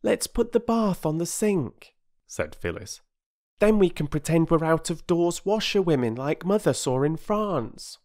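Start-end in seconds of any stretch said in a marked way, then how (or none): 0.0-1.9 put-on high-pitched girl character voice; eager girl. Child Phyllis proposing a washing game. 3.6-8.0 put-on high-pitched girl character voice; eager girl. Voices Phyllis in the story.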